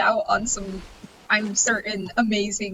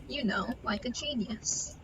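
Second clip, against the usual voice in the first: whispered sarcastic aside; 10 dB quieter than usual. Real, not acted. Sarcastic aside about nearly falling.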